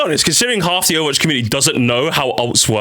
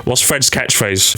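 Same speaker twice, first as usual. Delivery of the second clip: ordinary talk, nothing marked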